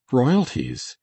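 high, surprised exclamation; exclaimed. A narrator's reading. Blair echoes the colonel's word, exclaiming. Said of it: "exclaimed Mister Blair".

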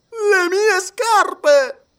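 very high, loud, drawn-out cry of dismay; shocked cry. A narrator's reading. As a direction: shriek at the sight of his own shoes. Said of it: "gridò Passepartout ... involontaria esclamazione". (grido stupito di Passepartout, acutissimo e forte)

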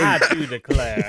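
slow, laughing Southern belle voice; mock Southern belle. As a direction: camp it up like a genteel Southern lady. Mocking the Southern accent.

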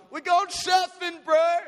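high, slow, stretched-out stoked voice; hyped surfer call. Retold or imitated. Mates waking in the car, calling it stoked and high. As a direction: sell the buzz of a surf morning.

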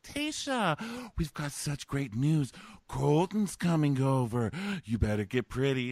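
gushing producers. Retold or imitated. Producers hype up the contestant for a visit, mock excitement. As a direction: imitate producers overselling great news.

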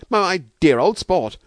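high, loud, delighted stutter; stammering delight. A narrator's reading. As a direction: stumble happily over the first word. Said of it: "Kirk was stammering in his delight".

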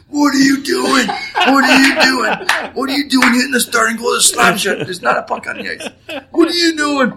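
high, loud, laughing mock tirade; coach yelling. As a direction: yell at the player like an outraged coach.

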